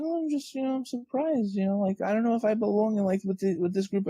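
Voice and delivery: high, timid little voice; imitating the shy developer doubting herself; mock bashful woman. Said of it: "And she's like".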